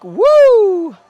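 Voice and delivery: a loud high whoop; excited woo. Real, not acted. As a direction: cheer out loud as the liftback rises. Reacts to the liftback opening.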